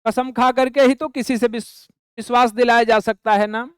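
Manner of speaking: usual speaking voice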